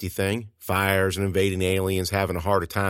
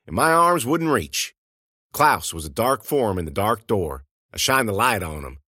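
ordinary delivery, nothing marked

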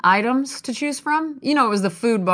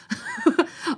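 very high little giggle; short high real laugh; squeaky laugh; 3 dB quieter than usual. Real, not acted. Laughing after shrugging it off.

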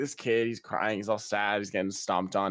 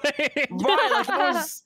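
squeaky amazement; 5 dB louder, much slower than usual. Real, not acted. Reacting to wild story.

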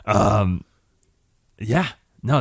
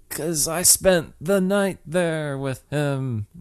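slow deliberate line; 3 semitones higher, 4 dB quieter, much slower than usual. Retold or imitated. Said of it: "She's like".

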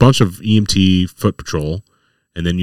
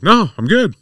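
higher, lightly voiced happy reveler; carefree patient; 14 semitones higher, 4 dB louder than usual. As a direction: imitate a harmless guy just enjoying himself.

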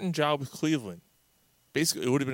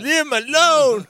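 hysterical pleading; 13 semitones higher, 8 dB louder than usual. Retold or imitated. Echoing the Leave [name] meme.

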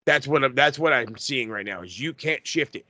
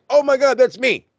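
much higher and louder, a startled shout; excited yell; 11 semitones higher, 6 dB louder than usual. Retold or imitated. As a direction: yell it like you just spotted yourself on TV. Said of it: "I yelled".

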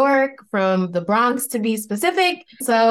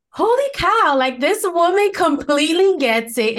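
much higher, thrilled rediscovery; excited exclamation; 8 semitones higher than usual. Retold or imitated. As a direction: blurt it out like a revelation. Retold discovery of the podcast.

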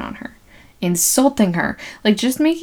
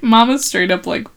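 normal talking voice

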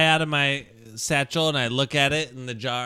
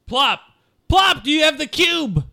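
high, loud call across the room; urgent raised-voice holler; shouting over; 14 semitones higher, 8 dB louder than usual. Acted. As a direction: shout it over to someone far off. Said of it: "I shout over there".

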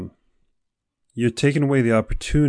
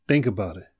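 ordinary talk

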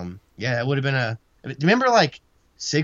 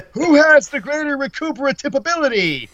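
Vince; 13 semitones higher, 5 dB louder than usual. Acted. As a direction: exclaim it in a high, hammed-up commentator voice. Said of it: "You gotta say it in Vince voice".

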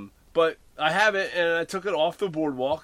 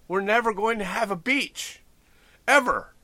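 exasperated yell; 7 semitones higher, 6 dB louder than usual. Retold or imitated. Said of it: "And I'm like".

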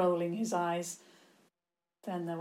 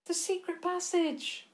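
put-on high-pitched, eager young woman; urgent idea; 11 semitones higher than usual. A narrator's reading. Fleeing girl suggests escape route.

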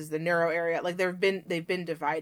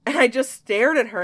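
ordinary delivery

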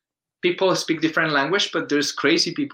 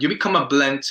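ordinary talk

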